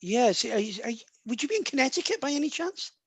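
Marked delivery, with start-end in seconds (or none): none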